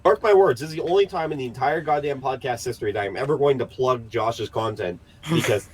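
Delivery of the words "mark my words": emphatic. Real, not acted. Emphatic aside.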